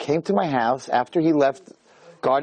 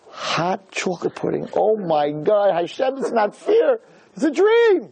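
swooning over pudding; 6 semitones higher, slower than usual. Retold or imitated. Craving forbidden pudding on diet.

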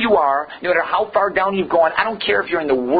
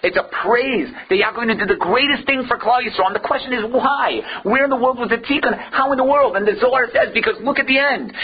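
fervent preaching; 7 semitones higher than usual. Acted. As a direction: hammer home the disbelief to the class. Impassioned rhetorical preaching.

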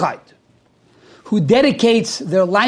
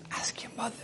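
stunned whisper to himself; an unvoiced, baffled whisper; 4 semitones higher, 19 dB quieter, faster than usual. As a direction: breathe the words out in disbelief. Repeating the baffling answer.

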